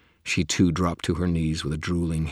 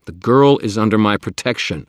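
loud, higher protective stand; firm loud declaration; 6 semitones higher, 11 dB louder than usual. Acted. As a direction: declare it with force.

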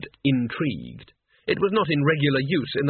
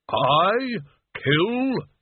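higher, indignant questioning, each word set apart; offended; 6 semitones higher than usual. Acted. Death answering a charge of killing people, offended. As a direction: balk at the accusation, affronted. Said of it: "said Death, obviously offended".

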